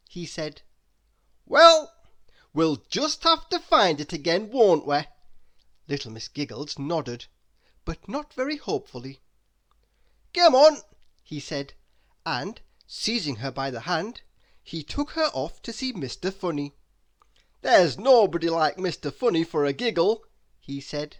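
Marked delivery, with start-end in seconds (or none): 1.5-5.1 loud, bright encouraging voice; rally her spirits heartily; jolly Mister Happy. Acted. 10.3-10.8 loud, much higher urging; eager call. Acted. Mister Happy urging. 17.6-20.2 louder, cheerful voice; hearty Mister Happy. Acted.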